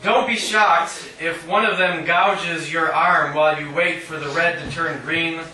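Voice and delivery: ordinary talk, nothing marked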